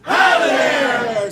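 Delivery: long, very loud shout; loud game-show elimination yell; shouted elimination call. Shown as a demonstration. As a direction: bellow it out to the crowd, drawn out. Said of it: "Try that with me".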